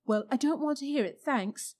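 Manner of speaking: Diggory refusing. A narrator's reading. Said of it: "said Diggory".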